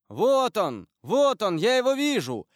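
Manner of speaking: loud, high, excited cries; urgent shout through a door. A narrator's reading. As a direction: raise the alarm on spotting a fugitive. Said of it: "крикнул голос за дверью".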